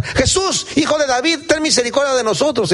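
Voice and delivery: high-pitched mock shout for help; acted shouting. Blind men start yelling for Jesus, crying out. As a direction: call out urgently, voice pitched high. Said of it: "Y empiezan a gritar".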